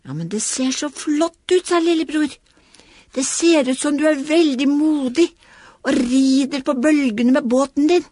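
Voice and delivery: lightly voiced child cheering on; small boy voice. Little boy praising his toy's bravery, encouraging.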